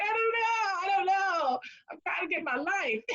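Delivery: imitating her younger self through tears; retold tearful reply. Answering the lady's question through half-laughing tears.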